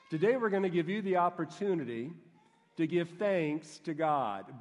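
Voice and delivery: ordinary delivery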